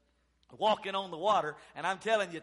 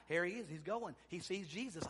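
usual speaking voice

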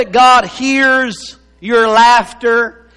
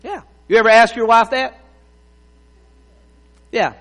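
normal talking voice

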